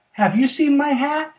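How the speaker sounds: high, loud, eager question; bear asking. Acted. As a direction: call it out high, rising at the end. Bear asks the turtle.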